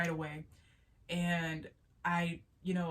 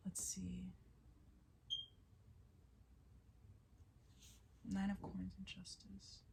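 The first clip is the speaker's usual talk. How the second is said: soft, slowed whisper to herself; 14 dB quieter, slower than usual. Real, not acted. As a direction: whisper the card names under your breath. Muttering while reading cards.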